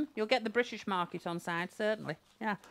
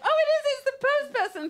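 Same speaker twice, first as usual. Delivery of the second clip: much higher and louder, hammed-up delight; mock surprise; 8 dB louder than usual. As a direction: gush over the postman in a big fake surprise.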